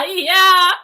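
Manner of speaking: loud burst of laughter; laughing hard. Real, not acted. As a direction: laugh out loud, then yeah. Laughing at hubby's collectibles.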